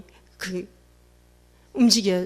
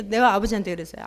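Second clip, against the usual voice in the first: ordinary talk, nothing marked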